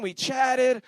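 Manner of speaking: usual speaking voice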